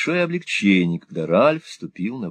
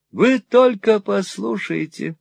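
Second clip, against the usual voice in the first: put-on high, wheedling old miser voice; fawning old moneylender; 13 semitones higher, slower than usual. Old usurer fawning on Ralph, high and wheedling. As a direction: simper it like a cringing usurer. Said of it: "воскликнул тот".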